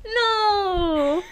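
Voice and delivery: loud, high cry of dismay; dismayed outburst. Real, not acted. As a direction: realize the blunder out loud. Realizing the dates are all wrong.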